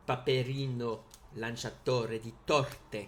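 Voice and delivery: put-on playful Italian accent, punched out; showy Italian accent. Collector giving viewers a card's Italian name, in a showy Italian accent. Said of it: "avec mon magnifique accent en plus".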